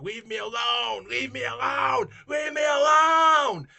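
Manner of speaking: high, drawn-out shout building to a scream; lunatic scream. Retold or imitated. Old woman screaming at the helper in the street, lunatic scream. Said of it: "screamed at me like a lunatic".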